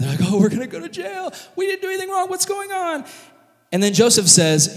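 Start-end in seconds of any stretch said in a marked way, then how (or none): 0.2-3.0 very high, squeezed mock-panic wail; hammed-up high-pitched hysterics; freaking out. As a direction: imitate brothers panicking in a squeal. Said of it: "they start freaking out".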